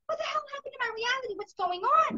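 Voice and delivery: high, fast incredulous outburst; retold bewilderment. Retelling her alarm at changed reality.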